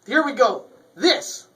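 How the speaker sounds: loud, high showman call; big fanfare opener; showman announcement. Acted. Show opening again.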